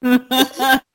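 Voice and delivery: high, slow New York catchphrase through giggles; mock New York accent. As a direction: ham up a New Yorker, laughing. Jokey New York mafia catchphrase.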